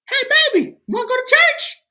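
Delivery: high, sweet imitation of mom calling; mom's sweet voice. Son retelling his mom's call, high sweet mom voice. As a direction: put on mom's high loving voice. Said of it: "my mom called me".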